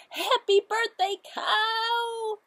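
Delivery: loud, high happy greeting; high cheerful cheer. Acted. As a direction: cheer for the birthday guest. Characters cheer for Cow.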